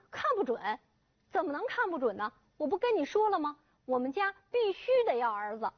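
furious father cornering the doctor; angry disbelief. Acted. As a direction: hurl the questions back in disbelief.

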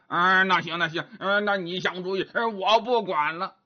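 pig-man voice, grunting between phrases; grunting Zhu Bajie, grumbling. Acted. Bajie asks how they get past the locked door, grunting grumble. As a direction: grumble and snort like a doubting pig.

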